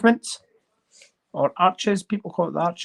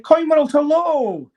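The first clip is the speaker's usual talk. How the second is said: high, slow, louder sing-song hello; call-out; 9 semitones higher, 5 dB louder, slower than usual. Real, not acted. Calling out to the Coin World channel, playful sing-song hello. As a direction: hail the channel from afar.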